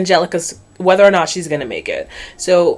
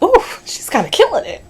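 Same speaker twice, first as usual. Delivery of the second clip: impressed ooh; 8 semitones higher than usual. Retold or imitated. Family impressed by Angelica.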